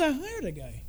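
offhand, quiet. Retold or imitated. Patient tells the doctor his secret, casually and softly. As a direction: toss it off quietly like it's nothing. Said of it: "He goes".

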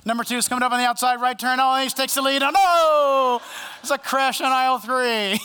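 fast play-by-play rising to a loud cry; mock race commentator. Preacher calls an imagined supermarket cart race, mock race commentator. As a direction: call a cart race like a sports announcer.

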